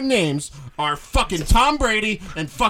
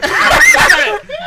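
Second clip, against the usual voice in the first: big burst of laughter, high and loud; real loud laugh; loud laughing shout; 10 dB louder than usual. Real, not acted. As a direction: bust out laughing at the jinx. Loud laugh at friend's jinx.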